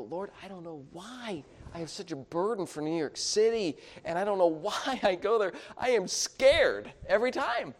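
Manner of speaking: high-pitched mock plea, climbing as it goes; pleading to God. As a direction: act out a bewildered cry to the Lord. Acts out own plea to God.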